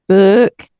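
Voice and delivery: "Book" with a long, heavy O and a loud, rising end. Retold or imitated. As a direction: lean on the O in "Book". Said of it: "I'm really heavy on the O's and book".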